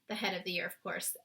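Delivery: ordinary delivery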